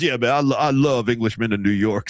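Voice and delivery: imitating a deep-voiced reporter; Mark Spears deep voice. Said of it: "he goes".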